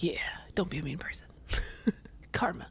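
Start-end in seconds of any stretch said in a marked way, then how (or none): 0.0-1.3 breathy whispered aside; hushed quick whisper. Retold or imitated. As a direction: whisper it like a little mock warning. Whispered mock advice aside.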